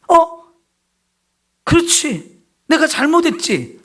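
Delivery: sudden realization. Retold or imitated. Believer suddenly realizing his sin, mildly startled. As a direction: let it dawn on you, caught off guard.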